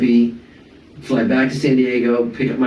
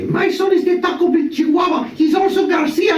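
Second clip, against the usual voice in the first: proud mom shout. Retold or imitated. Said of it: "my mom always lived vicariously through me".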